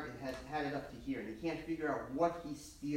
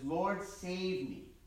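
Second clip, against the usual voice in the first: slow, drawn-out plea for help; a mild cry from someone sinking; higher, slow mock plea, understated; Peter calling out; 4 semitones higher, much slower than usual. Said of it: "he calls out to the Lord".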